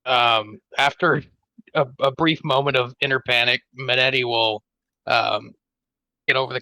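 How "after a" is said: ordinary talk, nothing marked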